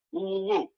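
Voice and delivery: soft high woo woo woo; high whoops. Retold or imitated. Celebrating the mall trip, soft high whoops. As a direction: whoop with glee.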